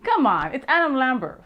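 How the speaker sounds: ordinary talk, nothing marked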